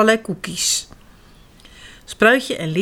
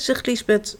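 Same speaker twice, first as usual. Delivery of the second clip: ordinary delivery, nothing marked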